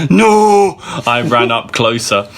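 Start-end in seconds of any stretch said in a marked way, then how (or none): 0.1-0.8 high loud cry of no; loud, high panicked no; shout. Acted. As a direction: yell it in alarm. Rushing to screaming friend.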